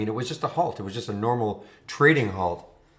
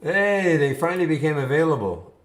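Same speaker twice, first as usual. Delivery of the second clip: excited; 5 semitones higher, 4 dB louder than usual. Real, not acted. Stock finally available.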